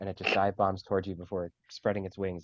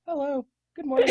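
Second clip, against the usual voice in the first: tiny bird voice; 6 dB louder than usual. Acted. Bird voice greeting.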